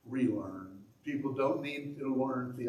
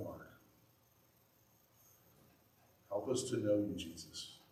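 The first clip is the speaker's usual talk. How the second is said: prayer. Acted. Leads closing prayer.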